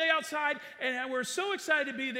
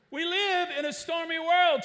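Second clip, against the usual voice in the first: much louder and higher, declaring it; preaching loud; 5 semitones higher, 7 dB louder than usual. Shown as a demonstration. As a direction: proclaim it to the whole room. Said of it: "Turn to the person next to you and say".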